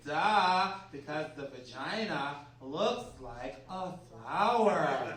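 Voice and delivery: slow, dopey imitation; dumbass voice. Imitating a clueless girl explaining the rose, slow and dopey. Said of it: "exactly how she said it with that dumbass face".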